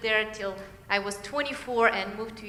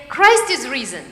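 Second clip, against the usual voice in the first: louder, ringing declaration; Easter proclamation; 9 dB louder than usual. Real, not acted. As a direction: proclaim it out to the whole church. Easter greeting to the congregation.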